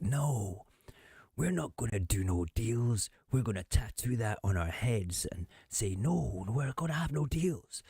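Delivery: hammed-up, high-pitched defiance; mock Keir Starmer. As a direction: send up a politician's insistence. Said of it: "gives Keir the opportunity to say".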